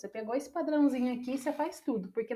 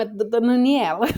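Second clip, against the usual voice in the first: mock stutter on one's own name, loud; hammed-up stumbling over the name; tongue-tied stammer; 8 dB louder than usual. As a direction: trip over the first sound, then blurt it out. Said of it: "a gente vai enrolar a língua".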